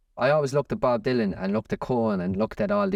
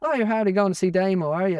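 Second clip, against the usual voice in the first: higher-pitched quote of a woman; woman passer-by; 8 semitones higher, 3 dB louder than usual. Retold or imitated. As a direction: mimic a surprised local woman, pitched up. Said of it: "And she goes".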